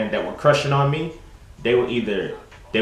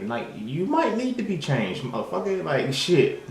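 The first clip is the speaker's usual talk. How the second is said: exasperated; 7 semitones higher, slower than usual. Real, not acted. Scolding people raised in trauma.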